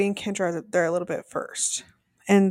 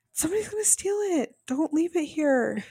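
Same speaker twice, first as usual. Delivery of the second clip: hysterical mock plea; 8 semitones higher than usual. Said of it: "screams it hysterically".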